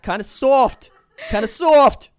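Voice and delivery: laughing mock taunt. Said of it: "To which I'm going".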